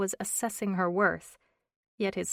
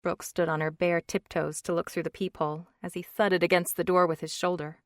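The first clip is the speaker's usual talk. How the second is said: usual speaking voice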